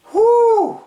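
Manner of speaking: very high, loud excited whew. Real, not acted. Fan reacting to an exciting match, loud whew. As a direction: let out a thrilled whew. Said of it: "needed the heart pills for this one".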